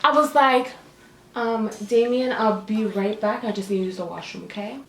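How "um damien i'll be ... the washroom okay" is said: soft, low, trailing-off excuse; sheepish excuse. Retold or imitated. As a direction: slip away quietly, fading to almost nothing. Acted excuse to slip away.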